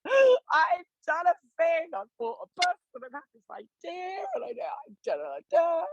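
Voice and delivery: high, breaking voice, half-shouted through tears; tearful retold sobbing. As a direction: replay the crying video, voice cracking high. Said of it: "crying my eyes out".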